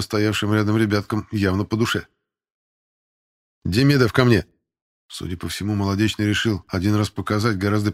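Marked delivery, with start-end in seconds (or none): 3.6-4.5 louder, brisk instructor's order; military command. Acted. Instructor summons Demidov from the line, commanding call. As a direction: call the recruit forward firmly.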